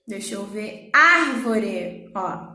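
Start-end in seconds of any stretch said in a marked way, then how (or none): 0.9-2.1 "Árvore" with a loud, long first "Ár"; stress on ÁR. Shown as a demonstration. Teacher illustrates proparoxítona, "Árvore" stressed on "Ár". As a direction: punch the opening of "Árvore", then fall away. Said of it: "É o ar".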